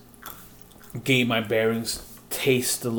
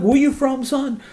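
much higher, louder mock mechanic calling him son; mechanic asking; 13 semitones higher, 5 dB louder than usual. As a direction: voice the tire guy sizing him up. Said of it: "they're like".

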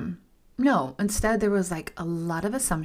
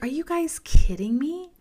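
retold disbelief; 4 semitones higher than usual. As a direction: ask it as if betrayed by friends. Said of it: "And I'm like".

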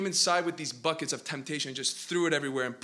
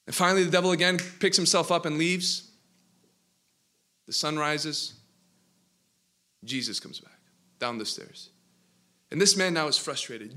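usual speaking voice

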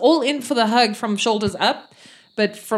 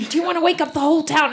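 fast, higher hushed scolding; irritated brother; 6 semitones higher, much faster than usual. Acted. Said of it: "his brother go".

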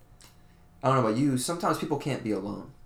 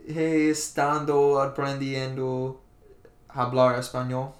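slow careful Spanish; 3 semitones higher, 3 dB louder, slower than usual. Shown as a demonstration. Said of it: "should mean I have been learning Spanish".

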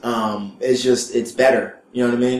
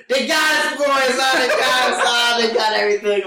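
Mister Ross crying; 11 semitones higher than usual. Retold or imitated. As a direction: imitate a man bawling that he's been robbed. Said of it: "why are you crying".

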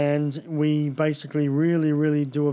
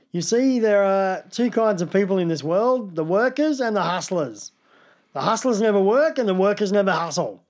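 bad Brian Brown impression; 4 semitones higher than usual. Impersonating Brian Brown's Australian accent for the podcast. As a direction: lay on an ochre Aussie twang, preachy. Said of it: "shite impressions".

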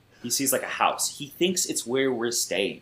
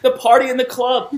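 high, louder mock rapper voice; rapper impression; 11 semitones higher, 6 dB louder than usual. Friend imitating a rapper on the phone, hyped. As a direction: hype it up like a club track. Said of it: "He's like".

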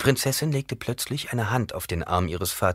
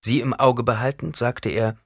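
ordinary delivery

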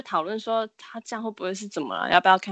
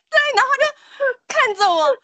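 words bursting out through laughter; laughing; 6 dB louder than usual. Real, not acted. Teller recalling slapping the possessed boy, laughing. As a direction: laugh as you tell it. Laughs while telling slap.

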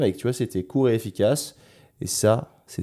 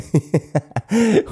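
burst of laughter; 14 semitones higher, 8 dB louder than usual. Real, not acted. Laughs about the four-star guy.

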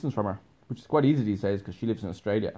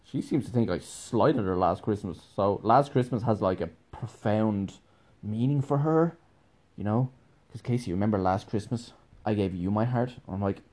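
ordinary talk

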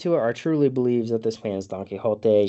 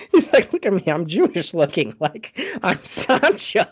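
high, laughing mock plea; laughing while imitating; 10 semitones higher, 5 dB louder than usual. Real, not acted. Retelling Sancho's begging while cracking up. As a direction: squeeze the plea out through laughter. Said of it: "begging for his life".

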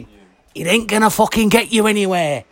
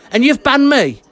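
usual speaking voice

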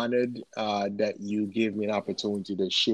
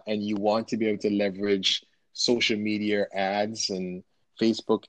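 normal talking voice

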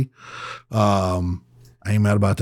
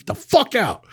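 higher angry command; mild mock yell; retold yell; 10 semitones higher than usual. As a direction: order him out. Throwing the guy out.

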